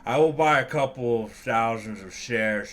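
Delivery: a smug rich man purring at the secretary; smug tycoon voice. Acted. Tusk voiced in game.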